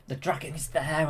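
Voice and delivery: ordinary talk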